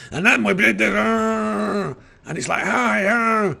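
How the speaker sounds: shrieking high, loud, fast hype; overhyped voice. Shown as a demonstration. Gamer mocking American YouTuber delivery, overhyped. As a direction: explode with fake energy. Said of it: "I can do that kind of voice, that excited, overhyped voice".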